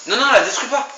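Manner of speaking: high, loud urgent protest; urgent shout. Real, not acted. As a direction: shout to stop him now. Stopping teammate destroying turret.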